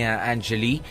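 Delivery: ordinary talk, nothing marked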